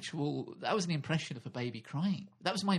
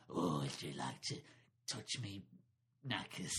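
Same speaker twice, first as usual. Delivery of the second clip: much lower, leering creepy grown-up; creepy adult voice; 8 semitones lower, 7 dB quieter than usual. Pretend. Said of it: "self going".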